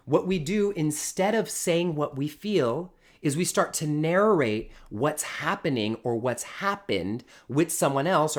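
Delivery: usual speaking voice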